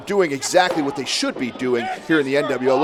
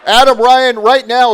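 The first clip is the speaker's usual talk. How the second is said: shouting; 7 semitones higher, 8 dB louder than usual. Real, not acted. Yelling at wrestler from desk.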